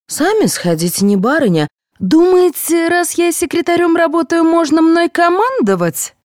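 insolent, climbing to high-pitched indignation; rude retort. A narrator's reading. Secretary refusing to fetch water, rude and indignant. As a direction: snap back that you take no orders. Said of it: "неожиданно схамила Аида".

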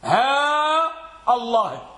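ها held long and high, short break before الله; lengthening and glottal stop. Shown as a demonstration. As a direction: stretch ها, then cut into الله. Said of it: "اللغة الأولى".

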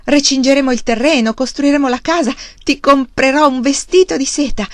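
loud, high, exuberant promises; thrilled, carried away, loud; excited shouting. A narrator's reading. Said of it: "Charles gridò".

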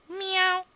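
high, loud mewing meow; sharp high cat cry. Acted. Cat meows.

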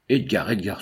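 ordinary delivery, nothing marked

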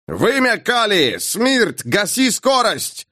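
high roar muffled by a tube; captain bellowing down a pipe; roar through speaking tube. Acted. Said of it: "Искажённый переговорной трубой рёв капитана".

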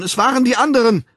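noch höher und schneller wiederholt, fast überschlagend; panisch anklagend. A narrator's reading. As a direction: die Schuld verzweifelt abwälzen. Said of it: "Er zeigte anklagend". (panisch gesteigert, Stimme überschlägt sich)

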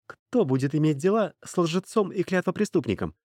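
high-pitched, relentless warning; threatening slave girl. A narrator's reading. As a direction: threaten him insistently, pitch held high. Slave girl threatens former master.

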